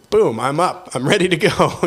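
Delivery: a burst of get-up-and-go energy; pumped up. Retold or imitated. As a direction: bounce into the day raring to go. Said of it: "And then it's like".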